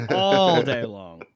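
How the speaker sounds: put-on slow Boston accent drawl. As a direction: stretch "all day long" in a Boston accent. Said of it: "talking in the Boston accent".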